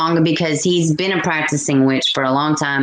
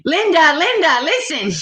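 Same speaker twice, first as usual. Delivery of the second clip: high, insistent quoted voice, pitched up; mock bossy commenter; 11 semitones higher than usual. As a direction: imitate her telling Linda to listen. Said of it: "she said".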